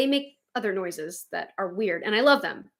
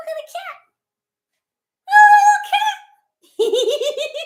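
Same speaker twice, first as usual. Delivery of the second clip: gushing coo; 12 dB louder than usual. Real, not acted. Showing viewers the new cat tattoo, cooing. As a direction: coo over something adorable.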